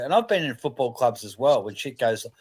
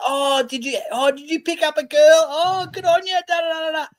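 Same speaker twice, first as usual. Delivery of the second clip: much higher, squealing gossip voice; mock gushing wag. Mimicking wags cheering a bloke who picked up a girl, high mocking squeal. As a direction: gush in a high put-on voice, half shouting. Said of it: "They'll be the first ones going".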